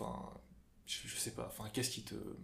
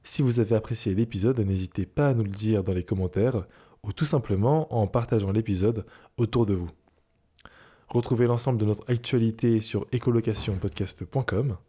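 louder, steady announcer read; podcast outro; 11 dB louder than usual. Acted. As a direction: read the sign-off like radio.

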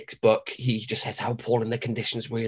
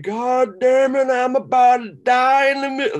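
bad Terry impression; 11 semitones higher, 8 dB louder than usual. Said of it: "That was the worst Terry fucking impression".

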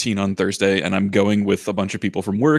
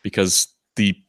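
ordinary delivery

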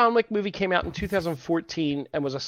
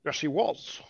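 soft, badly faked Irish accent; put-on Irish accent; 7 dB quieter than usual. Shown as a demonstration. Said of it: "that's my accent".

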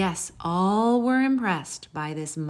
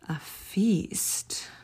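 whispered with dread; hushed, scared whisper. A narrator's reading. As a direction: breathe the word like a secret fear. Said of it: "whispered scary word".

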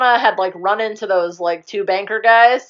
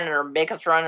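ordinary delivery

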